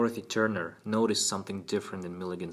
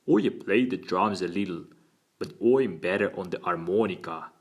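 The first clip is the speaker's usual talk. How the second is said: put-on louder, open, earnest Cockney boy; crisp Cockney accent; 6 dB louder than usual. A narrator's reading. Boy telling his lawyer his hobbies, crisp Cockney. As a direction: answer brightly as a Cockney lad. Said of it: "his crisp Cockney accent".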